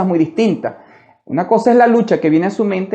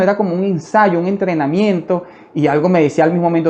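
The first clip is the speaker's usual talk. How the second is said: usual speaking voice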